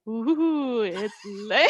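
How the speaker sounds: spooky voice. Pretend. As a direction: get back into the ghost voice. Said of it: "I'm trying to get back into it".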